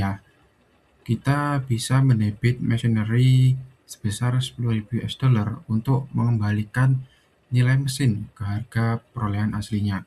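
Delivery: ordinary delivery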